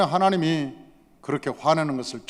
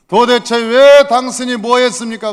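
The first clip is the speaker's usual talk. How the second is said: loud, high outraged question; indignant rebuke; 6 semitones higher, 10 dB louder than usual. Acted. Samuel arrives and confronts the one who worshipped first, indignant. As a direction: demand an explanation, hammering it out.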